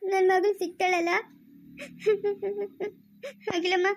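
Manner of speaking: tearful begging with sobs between pleas; crying. Acted. Mother begs confidante to find her child, crying. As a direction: plead desperately through tears. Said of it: "ಅಳ್ಬೇಡ ಸುಮ್ನಿರಮ್ಮ".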